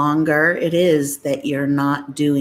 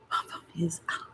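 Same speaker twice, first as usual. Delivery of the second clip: whispering; 13 dB quieter than usual. Real, not acted. Whispering to a sleeping child.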